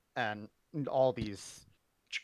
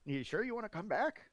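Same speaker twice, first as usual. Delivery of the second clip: higher, pleading brother voice; sincerely asking him back; NPC voice; 6 semitones higher than usual. Acted. NPC brother speaking to Mac.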